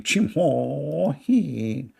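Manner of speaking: very slow put-on Chinese phrase; slow mock Chinese phrase. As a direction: fake Chinese, drawn out. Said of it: "I speak Chinese".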